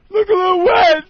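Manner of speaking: high, loud mock outburst; all pissed. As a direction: yell back like a taunted, furious customer. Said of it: "All pissed".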